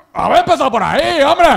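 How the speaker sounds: hammed-up exasperated comeback; mock shopkeeper retort. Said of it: "Como diciendo".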